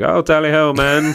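loud, high-pitched British officer bluster; hammed-up British military accent; cocky British general. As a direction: play a pompous British commander crying out from the bow. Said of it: "some fucking cocky British general".

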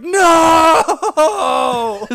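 high, loud laughing cry of horror; anguished scream. Acted. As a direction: shriek it out in mock anguish. Wife finds husband in grinder.

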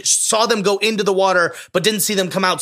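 ordinary talk, nothing marked

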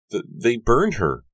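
high, loud, drawn-out anguish; stammer, then cry it out in grief; distressed, near sobbing. A narrator's reading. Susanna on her burned friend, high anguished cry. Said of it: "cut short by a sob".

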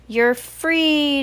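upbeat storybook cheer. A narrator's reading. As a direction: celebrate the release. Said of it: "Dad cheered".

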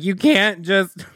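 high, stretched-out pleading wail; hammed-up desperate whine, slow and high; mock bawling. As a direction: imitate a tearful protest to the doctors.

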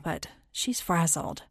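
ordinary delivery

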